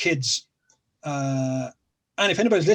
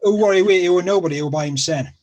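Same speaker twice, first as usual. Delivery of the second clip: Sheffield dialect, louder; 3 semitones higher, 7 dB louder, faster than usual. Shown as a demonstration. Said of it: "Okay, I caught half that".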